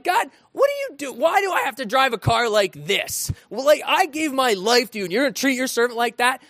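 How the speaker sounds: high whiny gripe to God, speeding up; hammed-up grumble; mock complaining. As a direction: complain to God like a wronged servant. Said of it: "I could complain and be like".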